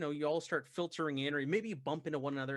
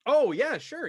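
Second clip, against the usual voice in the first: eager reply; 10 semitones higher, 12 dB louder, slower than usual. Acted. GM voices Jaden's answer.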